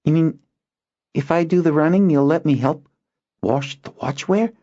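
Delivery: put-on high eager boy's voice; can't believe the offer; hopeful boy. Boy asking to help wash the watchware, hopeful.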